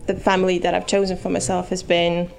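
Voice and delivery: usual speaking voice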